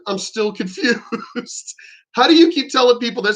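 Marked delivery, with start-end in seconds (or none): 0.1-1.8 softer, drawn-out words broken by a laugh; slow, amused disbelief; laughing bafflement. Retold or imitated. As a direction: laugh helplessly through the confusion. Baffled retort breaks into laugh.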